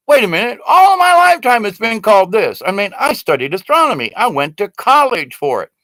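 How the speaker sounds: high, loud outraged objection; indignant protest. Acted. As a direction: protest as if your schooling was insulted. Indignant reply to renaming.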